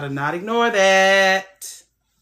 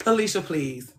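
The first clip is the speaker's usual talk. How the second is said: ordinary talk, nothing marked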